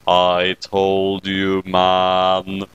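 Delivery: a deep deadpan critter gloating; low smug creature saying I told you so; smug Kyubey voice. Acted. Kyubey voice again.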